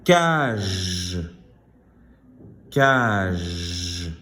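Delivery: "Cage" with a long zh at the end; final-sound demonstration. Shown as a demonstration. Teacher having pupils find the last sound, drawn-out "Cage". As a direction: stretch the final consonant of "Cage". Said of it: "Le dernier son".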